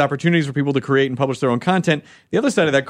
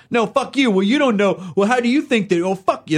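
mock internet argument; 6 semitones higher than usual.

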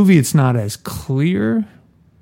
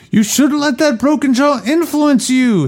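much higher put-on character voice; high Hagen voice; 13 semitones higher than usual. Reader voicing Hagen's advice to Michael in a high put-on voice. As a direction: read Hagen in a silly high voice.

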